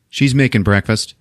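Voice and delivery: ordinary talk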